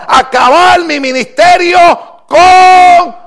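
loud, high, drawn-out preaching; preaching at full force. Acted. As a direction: thunder it out to the congregation. (predicación a todo pulmón)

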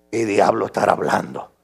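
low, soft puzzled aside; congregación desconcertada. Retold or imitated. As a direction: imitate baffled listeners under their breath. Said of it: "la gente dice". (comentario bajo de oyente perplejo, imitado)

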